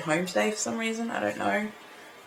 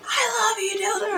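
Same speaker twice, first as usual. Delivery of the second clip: high squealed imitation of a yell; mock scream. Retelling her dared yell to the singer, mock screaming. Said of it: "I screamed it".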